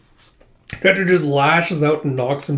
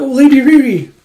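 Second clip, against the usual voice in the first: high, loud acted call; 11 semitones higher, 10 dB louder than usual. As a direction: call out in alarm after a lady. Calling out after Riri.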